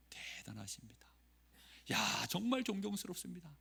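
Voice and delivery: hushed whisper of flattering praise; a soft admiring whisper. Pretend. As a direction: breathe the compliment like a secret. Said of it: "누가 여러분을 칭찬해 보십시오".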